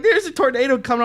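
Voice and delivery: high, loud imitation of a freaked-out kid; mock panic. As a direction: sound the alarm like a panicking kid. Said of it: "The kid's fucking freaking out".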